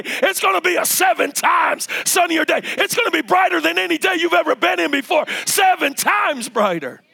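high, driving preacher's exhortation; impassioned preaching. Real, not acted. Preacher promising the congregation joy in the morning, impassioned preaching. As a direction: preach it at full force, punching each repeated phrase.

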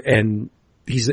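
ordinary talk

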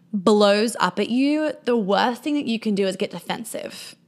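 ordinary delivery

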